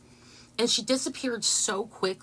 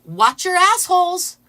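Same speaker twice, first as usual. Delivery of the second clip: loud, slow, high chant; loud, slow, high imitated protester chant; mock yell; 10 semitones higher, 11 dB louder than usual. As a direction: yell it slowly to the whole street. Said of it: "she says".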